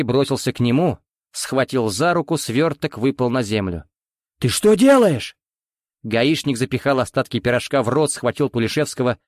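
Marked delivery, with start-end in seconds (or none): 4.4-5.4 loud, high angry shout. Acted. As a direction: bark it at him. Captain yells at grabbing man.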